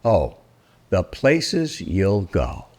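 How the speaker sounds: plain reading voice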